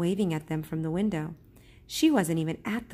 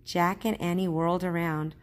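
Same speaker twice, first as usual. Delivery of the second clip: ordinary delivery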